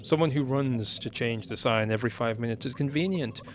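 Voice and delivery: ordinary talk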